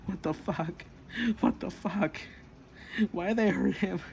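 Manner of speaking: high acted panic between heavy breaths; re-enacted breathless freak-out; breathing heavily, panicking. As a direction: gasp it out as if near a panic attack. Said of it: "she was breathing heavily".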